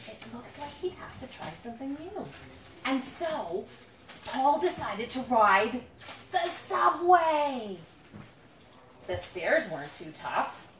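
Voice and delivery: storybook narration for little ones; reading to children. A narrator's reading. Parent reading a picture book to the children, storybook voice. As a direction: read it to the kids gently.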